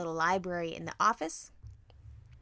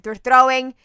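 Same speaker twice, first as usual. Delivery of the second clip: slow loud insistent shout; shouting to make a point; 2 semitones higher, 14 dB louder, slower than usual. Retold or imitated. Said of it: "I said".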